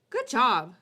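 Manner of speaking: good job punched loud; emphatic good job. Real, not acted. Streamer insisting to chat, loud and emphatic. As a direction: bark out a sincere good job.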